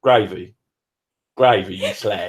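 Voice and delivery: a hint of Cockney, with a laugh; lightly mock the London accent. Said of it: "That's what I was trying to do".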